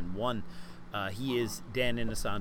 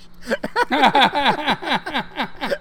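high, loud cackling laugh; burst of laughter; 14 semitones higher, 11 dB louder than usual. Real, not acted. Guest tells of being asked if British, bursts out laughing. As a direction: crack up loudly, high-pitched.

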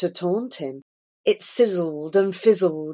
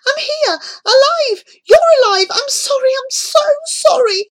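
very high, loud, desperate cry; frantic high-pitched calling out; screaming plea; 6 dB louder than usual. A narrator's reading. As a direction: scream out to be heard, begging forgiveness. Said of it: "tried to shout, to scream, to call out".

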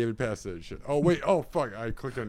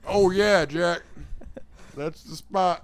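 mock pleasure; 5 semitones higher, 6 dB louder than usual.

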